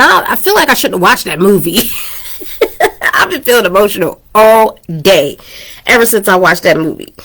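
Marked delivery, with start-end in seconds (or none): 2.5-5.4 laughing through it. Real, not acted. Laughs at herself mid-confession.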